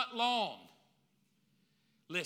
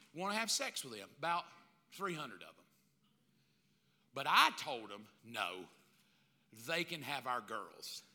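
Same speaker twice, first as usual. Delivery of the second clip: lower, softer mock confession to his wife; sheepish husband; 8 semitones lower, 5 dB quieter than usual. Husband sheepishly tells his wife he offered their girls, low and soft. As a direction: ease the bad news out sheepishly. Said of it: "I would have said".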